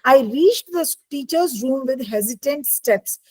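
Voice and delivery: very slow, careful dictation; slow reading aloud. Shown as a demonstration. Teacher reading the textbook line slowly to her class. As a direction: read each word slowly so they can follow.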